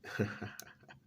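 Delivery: sinister chuckle. Acted. Mocking his scared face.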